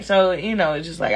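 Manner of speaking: ordinary talk, nothing marked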